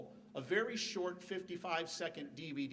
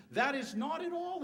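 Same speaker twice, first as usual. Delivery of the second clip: voice crack; 9 semitones higher than usual. Real, not acted. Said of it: "I just went through puberty".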